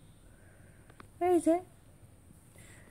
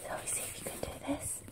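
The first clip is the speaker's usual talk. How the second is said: very soft, fast whisper; whispered question; 18 dB quieter, much faster than usual. Real, not acted. Parent asking toddler to try something new, whispering. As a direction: repeat it under the breath, barely audible.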